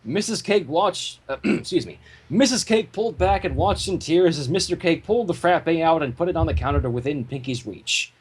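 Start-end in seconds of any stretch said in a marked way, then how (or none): none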